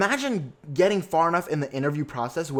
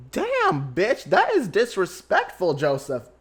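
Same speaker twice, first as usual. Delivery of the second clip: much higher and louder, slowed, scandalized; scandalized shriek; 5 semitones higher, 3 dB louder, slower than usual. Real, not acted. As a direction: shriek it like you can't believe he said that. Outraged at the rude greeting.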